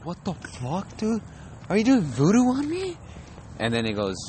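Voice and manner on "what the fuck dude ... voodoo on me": high-pitched mock panic; alarmed victim. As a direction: voice climbing in comic alarm. Acts out the cursed victim.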